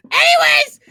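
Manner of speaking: very high, loud squeal to move on; comically shrill change of subject; mock shriek. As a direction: squeal it out to drown the embarrassment.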